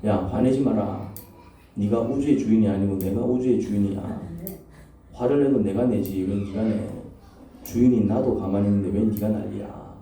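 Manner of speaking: low, slow, measured rebuke; God. Retold or imitated. God telling the preacher not to get angry, low slow rebuke. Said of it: "요즘 제가 듣는 하나님 말씀".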